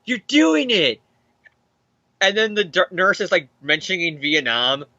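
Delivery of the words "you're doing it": put-on high child voice, cheering dad on; a little boy's happy cheer, pitched up; lightly voiced young son, bright cheer; kid voice. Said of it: "Robbie's son's like".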